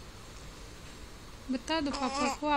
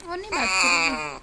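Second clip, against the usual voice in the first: lower, louder coaxing question; 7 semitones lower, 7 dB louder than usual. Real, not acted. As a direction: press her gently but firmly to talk, low and loud. Father coaxing silent daughter on call.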